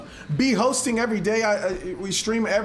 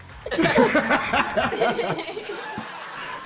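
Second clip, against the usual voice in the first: long laugh; 8 semitones higher than usual. Real, not acted. Laughing at the tip joke.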